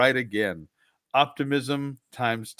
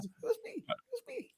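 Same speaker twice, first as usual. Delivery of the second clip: put-on very high, tiny child confession; squeaky kid voice; 16 dB quieter, much faster than usual.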